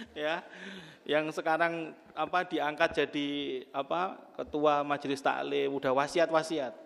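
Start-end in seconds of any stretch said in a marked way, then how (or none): none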